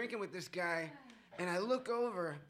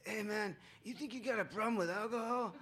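lightly voiced guy asking a mock-concerned question; a hint of a pushy stranger; 3 semitones higher than usual. Retelling the guy who asks about his drinking, a touch of mockery. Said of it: "he's like".

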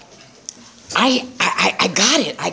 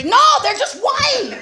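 much higher and slow, drawn-out no; insistent denial; slower than usual. Retold or imitated. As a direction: insist it innocently, dragging it out. Insisting, laughing.

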